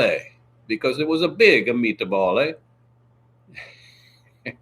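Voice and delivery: hammed-up Italian accent with tacked-on eh; mock Italian accent. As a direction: play the comic Italian telling a meatball tale. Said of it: "was really enjoying my Italian".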